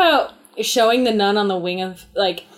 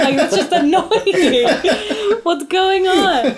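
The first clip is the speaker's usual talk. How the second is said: higher, very slow, laughing exasperation; mock annoyance; 8 semitones higher, much slower than usual. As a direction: drag it out, annoyed and amused. Mocking the loud plane noises.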